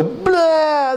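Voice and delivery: high, drawn-out "blah" tantrum wail; tantrum outburst. Acted. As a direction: act out a kid blowing up. Acts out a child's tantrum.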